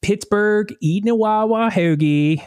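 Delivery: put-on high-pitched, improvised Pittsburgh accent; broad put-on Pittsburgh voice. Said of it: "I'm gonna try to do Pittsburgh".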